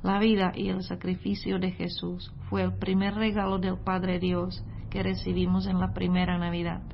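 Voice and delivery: ordinary talk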